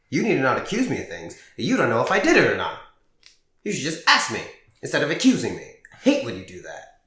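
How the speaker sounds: loud, fast, indignant protest; retold indignant comeback. Teen flipping the blame on mom, loud and fast. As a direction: fire it back at her, fast and loud.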